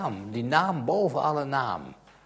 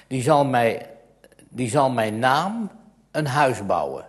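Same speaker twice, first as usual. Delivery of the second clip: raised, solemn reading of scripture; solemn Bible reading; 3 semitones higher, 7 dB louder than usual. Acted. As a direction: read it out like a preacher from the pulpit. (plechtig voorgelezen bijbeltekst)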